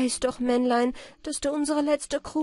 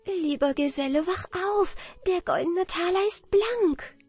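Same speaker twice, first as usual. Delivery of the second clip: high, rejoicing wake-up call; jubilant cry; 10 semitones higher than usual. A narrator's reading. Anna Barbara wakes her companion with good news, jubilant. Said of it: "Jubelnd rief Anna Barbara".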